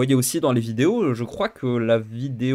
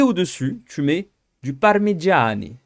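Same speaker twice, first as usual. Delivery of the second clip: slow hammed-up Italian accent on parmigiani; 3 semitones higher, slower than usual. Giving his moussaka recipe to viewers, playful mock Italian accent. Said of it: "pourquoi je prends un accent italien".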